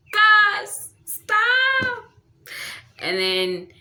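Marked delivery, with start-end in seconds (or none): 0.1-2.0 high, loud, drawn-out swooning squeal; overcome fangirl squeal. Real, not acted. Reader gushes to viewers over Darcy's confession, high loud squeal. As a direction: squeal it, as if the romance is too much. Gushing over the romantic quote.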